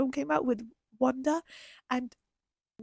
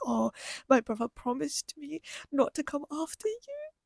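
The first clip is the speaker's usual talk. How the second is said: tearful retelling; 3 semitones higher than usual. Retold or imitated. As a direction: let it stretch and lift, choking up. I'm gonna start crying again.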